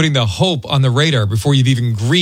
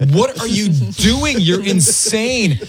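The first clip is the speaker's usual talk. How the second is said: mock incredulous; 6 semitones higher, much slower than usual. Retelling his reaction to his wife.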